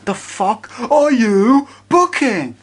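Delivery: high-pitched, drawn-out disbelief; exasperated rant. Acted. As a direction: berate the booker slowly, word by word. Ranting at an imagined booker.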